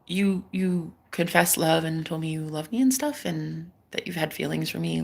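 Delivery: soft, low, halting retelling; stunned hesitation. Retold or imitated. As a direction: replay the confusion softly, stumbling in. Retold crush, stunned and hesitant.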